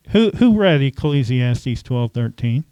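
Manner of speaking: ordinary delivery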